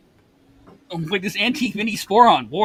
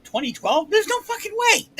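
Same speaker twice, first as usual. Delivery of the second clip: incredulous disbelief; 3 dB louder, slower than usual. Retold or imitated. Retelling disbelief at the bottle date.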